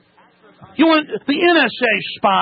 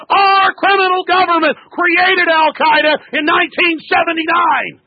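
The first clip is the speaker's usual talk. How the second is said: angry shouting; 6 semitones higher than usual. Real, not acted. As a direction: berate him at full volume. Host berating caller on air.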